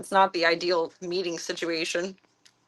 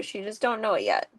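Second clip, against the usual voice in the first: ordinary delivery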